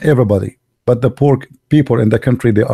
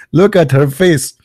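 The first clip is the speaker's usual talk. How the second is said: ordinary delivery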